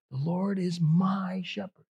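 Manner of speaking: MY drawn out, slow and personal; slow line leaning into "my"; stress on my. Shown as a demonstration. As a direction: linger on the word "my". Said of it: "There's a personal part of that".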